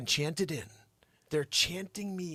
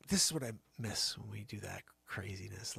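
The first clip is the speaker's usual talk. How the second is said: ordinary delivery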